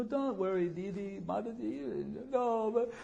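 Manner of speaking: high, wailing mock sympathy; a hammed-up tearful consolation; high mock crying to the widow; mock weepy comforting. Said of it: "they go".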